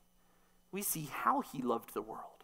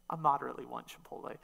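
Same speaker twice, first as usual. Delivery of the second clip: lower, fast and dry; deadpan understatement; 6 semitones lower, much faster than usual. Pretend. Preacher undercutting the craving joke, low, quick deadpan. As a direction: toss it off flatly. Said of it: "Not really. Maybe a little".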